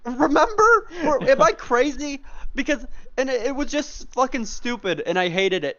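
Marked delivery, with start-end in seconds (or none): 0.1-2.2 laughing question, pitched up and louder; real laugh through the words, voice jumping up; laughing, high. Real, not acted. Asking his cohost to remember, laughing and pitched high. Laughing while recalling a story.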